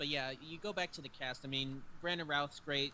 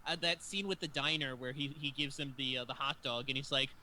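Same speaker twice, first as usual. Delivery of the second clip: normal talking voice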